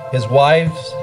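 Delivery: ordinary talk, nothing marked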